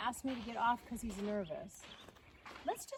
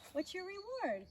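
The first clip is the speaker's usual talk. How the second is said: usual speaking voice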